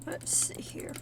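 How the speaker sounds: whispered aside to herself; whispering. Real, not acted. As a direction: whisper it under your breath. Muttering to herself while searching.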